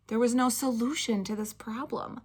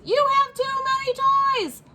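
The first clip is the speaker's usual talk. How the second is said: high, loud scolding shout; exasperated yell; 4 dB louder than usual. Acted. Fed-up mom shouting at Spencer about toys, high and loud. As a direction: lose patience and shout it up.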